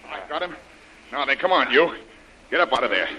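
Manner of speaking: loud and high, pouncing on the follower; triumphant shout. Acted. Hero cornering the follower in the bushes, loud and triumphant. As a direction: bark it out as you corner him.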